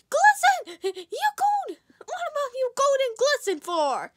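very high, loud shriek; shrieked surprise. Acted. Parent shrieking at Glisten's gold antlers, very high. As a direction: shriek it in amazement.

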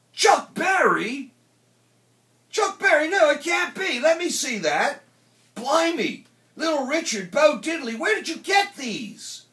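high, loud, incredulous character voice; excited young Liverpudlian astonishment; amazed Teddy Boy. A narrator's reading. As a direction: shout it in disbelief, pitch way up.

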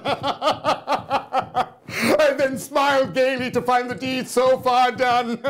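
slow, drawn out through laughter; laughing gloat. Acted. Madman gloating to his listener over the deed, laughing through the words. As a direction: savour it with a laugh in the voice.

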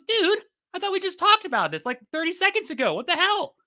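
high, outraged disbelief at the betrayal; exasperated frog. Acted. Frog protests to the scorpion who stabbed him, high and outraged. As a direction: squawk it out like a stabbed, indignant frog.

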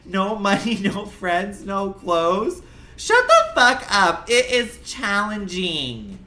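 loud, high, fed-up rant; real frustrated yelling in self-defense; angry defensive outburst. Real, not acted. Snaps back at viewers after failing.

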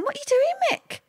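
high-pitched quote of herself asking her husband; puzzled question. Retold or imitated. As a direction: squeak it up in disbelief. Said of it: "I used to say to him".

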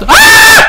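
real high, loud shriek of fright; startled scream. Real, not acted. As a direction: scream at a sudden scare. Jump scare in horror game. (korkuyla tiz çığlık)

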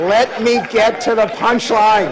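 loud, high, drawn-out plea to the crowd; insisting over laughter. Real, not acted. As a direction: holler slowly over a laughing audience. Talking over the audience's laughter.

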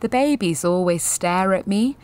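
ordinary talk, nothing marked

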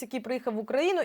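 ordinary delivery

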